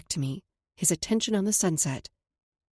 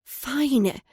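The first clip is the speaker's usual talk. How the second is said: huffed; 6 semitones higher, 5 dB louder than usual. Acted. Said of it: "I huffed".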